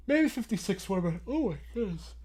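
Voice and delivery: words overtaken by a yawn. Real, not acted. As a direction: talk through a real yawn. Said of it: "I don't know why I'm yawning so much".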